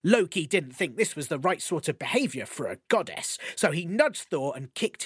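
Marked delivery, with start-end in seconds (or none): none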